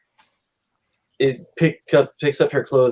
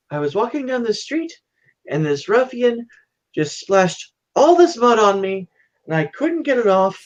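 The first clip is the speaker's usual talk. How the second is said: high-pitched put-on daughter voice; innocent daughter's excuse; 9 semitones higher than usual. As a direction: plead innocence to a father, acting it up. Daughter excuses herself to father.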